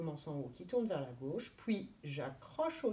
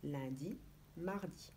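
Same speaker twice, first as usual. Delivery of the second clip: soft counting; 4 semitones lower, 10 dB quieter than usual. Shown as a demonstration. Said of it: "on compte sur les doigts".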